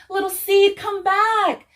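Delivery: high, loud desperate cry; calling out. A narrator's reading. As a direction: cry out after a lost friend. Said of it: "cried Little Fox".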